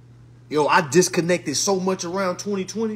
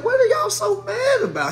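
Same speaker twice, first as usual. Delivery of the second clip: high incredulous question; 13 semitones higher than usual. Retold or imitated. Exasperated question to crowd.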